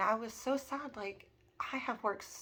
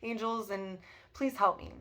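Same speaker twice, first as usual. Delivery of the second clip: louder, mildly panicked plea; understated replay of freaking out; retold inner panic; 6 dB louder than usual. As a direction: call for help with a touch of panic. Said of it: "I'm freaking out inside".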